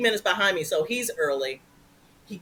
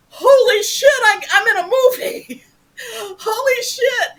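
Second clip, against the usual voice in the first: much higher and louder, laughing; elated shout; 13 semitones higher, 7 dB louder than usual. Real, not acted. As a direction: burst out with joy. Elated movie news shout.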